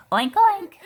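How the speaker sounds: high loud oinks; playful oinks. A narrator's reading. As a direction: tease with oinks. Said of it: "said Mercy".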